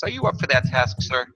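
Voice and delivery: normal talking voice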